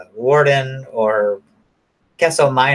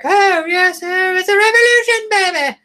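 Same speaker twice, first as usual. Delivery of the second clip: very high-pitched droid butler voice; a mock golden droid, pitched very high; C-3PO voice; 4 dB louder than usual. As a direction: squeak it up like a fussy protocol droid. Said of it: "old fucking three P O".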